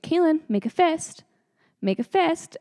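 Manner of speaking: high, loud instruction; urgent hygienist. Retold or imitated. Hygienist instructing a patient who is losing control, urgent imitation. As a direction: imitate a nurse raising her voice at a patient.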